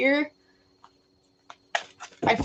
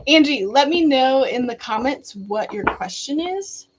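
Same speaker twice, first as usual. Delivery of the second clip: ordinary delivery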